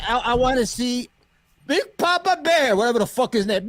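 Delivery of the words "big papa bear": mocking nickname. Real, not acted.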